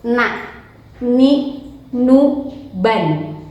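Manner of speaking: teacher drilling the n sounds; drill recital. Shown as a demonstration. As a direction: chant the syllables for the class. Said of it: "na, tarik ke belakang".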